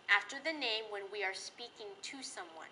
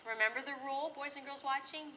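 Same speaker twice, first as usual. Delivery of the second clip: usual speaking voice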